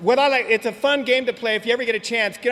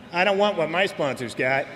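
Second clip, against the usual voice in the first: lower, offhand sponsee voice; imitating the sponsee; 5 semitones lower than usual. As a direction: toss it off lower like the casual sponsee. Sponsee's blunt answer quoted.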